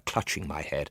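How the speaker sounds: ordinary delivery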